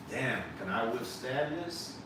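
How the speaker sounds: ordinary talk, nothing marked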